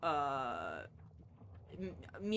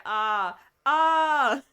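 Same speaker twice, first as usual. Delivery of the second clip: hammed-up rising scream, much louder and higher; mock scream; 7 semitones higher, 13 dB louder than usual. Voicing a dying victim in the game, hammed-up scream. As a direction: let out a pretend agonised scream.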